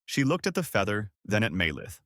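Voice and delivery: usual speaking voice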